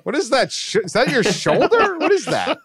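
high, laughing disbelief at a giant's body; laughing astonishment. Retold or imitated. To friends, retelling seeing the strongman's body, laughing in disbelief. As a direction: gasp it out, amazed and giggling.

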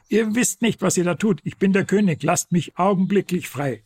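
high, desperate protesting shout; shouting king. Acted. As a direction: cry out to be released. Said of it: "Anfangs wehrte sich der König und schrie".

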